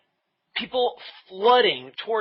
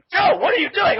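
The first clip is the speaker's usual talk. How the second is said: high, loud mock yell; imitating a panicked friend, voice shooting up; frantic protest; 8 dB louder than usual. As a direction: call out in exasperated disbelief. Retold frantic protest to Joe.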